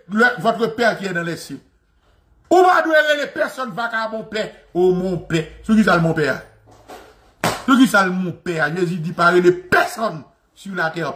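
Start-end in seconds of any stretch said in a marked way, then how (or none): none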